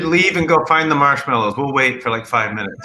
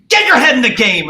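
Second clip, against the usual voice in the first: imitating Richard Kind yelling, high and loud; retold angry shout; 8 semitones higher, 7 dB louder than usual. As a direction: bellow it as a competitive player's outburst. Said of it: "and he goes".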